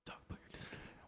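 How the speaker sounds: unvoiced hushed whisper. Coworker whispers the warning about the sharpener. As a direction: breathe it out like a secret warning.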